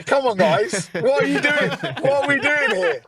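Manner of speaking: playful mock exasperation, pitch up; mock outrage. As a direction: tease them like a fed-up teacher. Mock outrage at portrait cameras.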